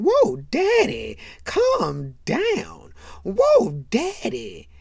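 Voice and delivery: loud, high, drawn-out mock protest; alarmed calming cry. Gerard's mom telling Daddy to calm down, hammed-up high cry. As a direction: wail it out, hamming up the alarm.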